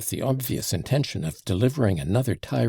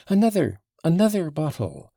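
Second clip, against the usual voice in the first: high, louder call; crying out; 9 semitones higher, 5 dB louder than usual. A narrator's reading. Mitya calling to the innkeeper, crying out. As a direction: call out eagerly for more. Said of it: "Mitya cried to the innkeeper".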